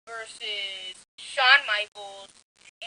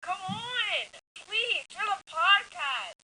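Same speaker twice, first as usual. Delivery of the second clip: pleading; 10 semitones higher, much slower than usual. Real, not acted. Begging friend to do accent.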